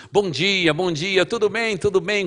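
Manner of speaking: high, loud, beaming good mornings; loud cheerful greeting. Retold or imitated. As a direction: greet the whole building loudly and happily. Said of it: "Então fala alto, mas fala coisa boa". (bom dia alto e alegre)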